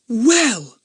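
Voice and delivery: put-on high, loud girl's voice, outraged; indignant girl. A narrator's reading. Dinah finding her voice first, high and indignant. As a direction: Dinah bursting out, scandalised.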